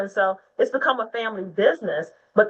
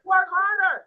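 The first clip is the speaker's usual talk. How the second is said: higher, voice cracking with emotion, listing every role; passionate, near tears; 7 semitones higher than usual. Real, not acted. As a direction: pour it out, fired up and close to crying. Speaker's passionate rant on women's work.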